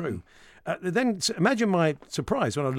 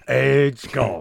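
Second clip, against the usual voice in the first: a louder mock Raymond Burr growl on 'Ed's gone; 7 dB louder than usual. Host mimicking the boy's broken voice, lightly voiced Raymond Burr. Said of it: "What, like Raymond Burr?".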